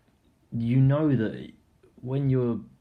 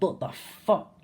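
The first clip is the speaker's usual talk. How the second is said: shocked; 10 semitones higher, slower than usual. Retold or imitated. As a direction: blurt it out in disbelief. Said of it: "we was like".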